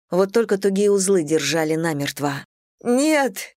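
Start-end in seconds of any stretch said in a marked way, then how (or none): 2.8-3.5 sobbing cry. Acted. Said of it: "всхлипнула я".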